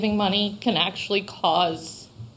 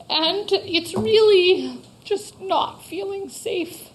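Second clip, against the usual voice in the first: high, tight, tearful pleading, slowing at the end; holding it together while near tears; emotional; 10 semitones higher, slower than usual. Real, not acted. Shop owner telling council she feels unsafe, tearful. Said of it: "I'm getting emotional".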